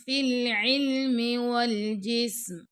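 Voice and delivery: slow, measured tajweed chant; Quran recitation. Shown as a demonstration. Teacher reciting the verse to students, slow and melodic.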